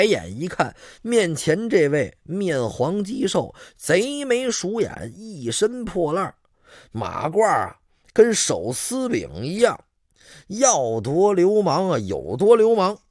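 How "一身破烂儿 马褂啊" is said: ordinary delivery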